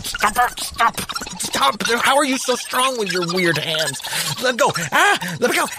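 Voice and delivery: high, pleading mock panic; hammed-up struggle. Host pretending raccoons drag him off, high mock panic.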